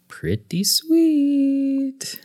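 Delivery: "sweet" stretched long and rising high; playful gushing. Real, not acted. Co-host praises friends' new showroom, playfully drawn out. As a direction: draw out the praise, slow and playful.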